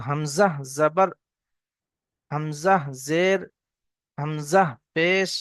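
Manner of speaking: slow, syllable by syllable; slow model reading. Shown as a demonstration. Teacher models the reading slowly. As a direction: spell out Hamza with each mark for the learners. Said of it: "এরকম পড়বো না, বরং".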